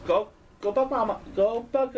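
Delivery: ordinary talk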